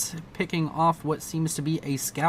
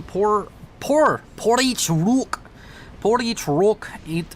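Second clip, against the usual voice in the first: put-on higher, drawn-out silly foreign accent on "Poor each rook"; annoying mystery accent; 4 semitones higher, slower than usual. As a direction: ham up a strange unplaceable accent, slow and high. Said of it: "with a non-annoying accent".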